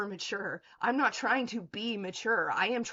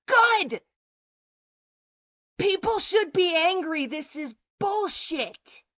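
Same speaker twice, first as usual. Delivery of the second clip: shouted "Good!", much higher and louder; angry shout; 7 semitones higher, 8 dB louder, slower than usual. Real, not acted. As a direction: yell it back at chat, furious. Angry rant at chat.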